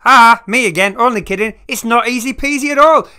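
cheeky loud comeback; loud, excited interruption. Pretend. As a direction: pop back in loud and high. Pops back in to correct.

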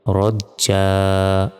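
রজ্জা drawn out, final a held, no n; রজ্জা stopped without nunation, slow. Shown as a demonstration. Teacher showing how to stop on two fathas, slow. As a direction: end রজ্জা on a long open a, dropping the double-fatha n. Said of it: "এভাবে উচ্চারণ হবে।".

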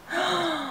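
high, soft intake of breath; thrilled little gasp; excited gasp. Real, not acted. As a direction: gasp with delight. Excited over the highlighters.

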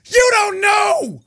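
high, loud mock yelling with a drawn-out start; playfully shouting down imagined critics. Mocking viewers who yell at him, loud and high.

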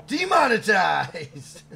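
loud, drawn-out joking call; mock shout. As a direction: belt it out slowly, half laughing. Mock announcement after crude joke.

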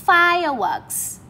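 fireworks drawn out, FIRE high, works falling; stress on first syllable. Shown as a demonstration. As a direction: slowly punch FIRE in fireworks, drop works. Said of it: "chúng ta đã gạch hết trọng âm một".

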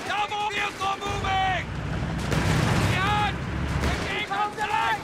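urgent vehicle crew warning; shouted combat alert. Acted. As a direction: yell out the enemy sighting. Crew spots enemy vehicles.

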